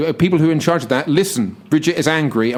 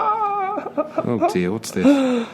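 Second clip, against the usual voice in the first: laughing; 12 semitones higher, 3 dB quieter, much slower than usual. Real, not acted. Breaks up laughing in sketch.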